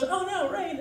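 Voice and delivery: high, soft little mock dismay; dainty movie heroine. Heroine caught in rain on rowboat date, daintily mock-dismayed. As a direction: coo it in a high, delicate voice.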